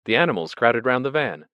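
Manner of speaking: ordinary delivery, nothing marked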